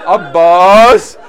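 loud, drawn-out call to a brother. Retold or imitated. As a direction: cry the name out across a distance. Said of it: "called out to her brother".

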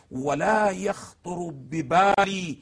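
slow, drawn-out quoted text; slow recitation. Acted. Lecturer quoting the sermon to the host, slow recitation. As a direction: read the Imam's words slowly, weighing each.

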